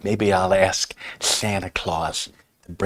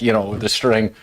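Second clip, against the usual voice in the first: ordinary talk, nothing marked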